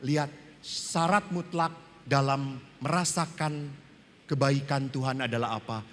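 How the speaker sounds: usual speaking voice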